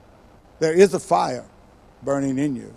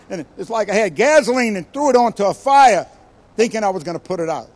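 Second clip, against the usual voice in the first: ordinary talk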